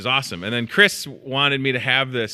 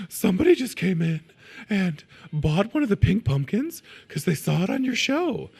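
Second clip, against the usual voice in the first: Catherine impression; 9 semitones higher than usual. Coworker Catherine excitedly tells him about a pumpkin sale. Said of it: "I'll do my Catherine impression".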